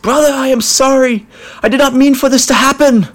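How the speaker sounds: high desperate cry. Acted. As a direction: cry out in anguish. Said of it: "cried out".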